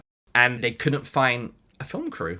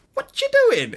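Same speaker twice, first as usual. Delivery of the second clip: much higher, sing-song question; surprised editor; 5 dB louder than usual. Retold or imitated. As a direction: catch him in the act, amused. Editor catches him editing.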